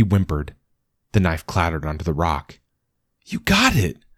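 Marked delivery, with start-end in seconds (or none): 3.2-4.0 thrilled cry of relief; excited shout, far higher. Acted. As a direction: cheer the little dog on. Dog returns with the knife.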